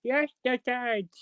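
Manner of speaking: drawn-out, high Hull catchphrase; imitated Hull accent. Fan copying a favourite character, imitated Hull accent. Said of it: "I fucking love that character".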